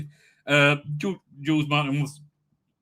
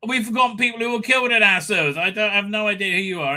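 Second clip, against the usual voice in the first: higher, fast, a touch louder brush-off; lightly voiced haughty lord, mildly dismissive; 5 semitones higher, 5 dB louder, faster than usual. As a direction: wave him away as a lord who can't be bothered.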